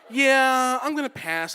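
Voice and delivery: blunt breezy rejection. Retold or imitated. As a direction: repeat it loudly, drawing out the first word. Rejection repeated louder and blunter.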